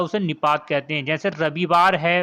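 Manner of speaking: ordinary talk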